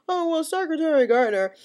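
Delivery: a sneering impression of the interviewer; mocking David Gregory. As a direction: parody the host's question, dragging it out. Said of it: "David Gregory was like".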